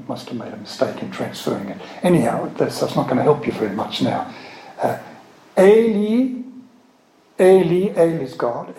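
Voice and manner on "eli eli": Eli called out high and loud, EH-lee; Hebrew Eli. Shown as a demonstration. Teacher calling out the Hebrew Eli to the class. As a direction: cry out Eli, stress on the first syllable. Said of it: "Eli, my God".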